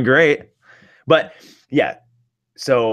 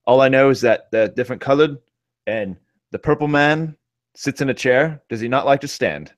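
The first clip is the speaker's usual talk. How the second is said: imitating Thor; 3 semitones higher than usual. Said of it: "and he goes".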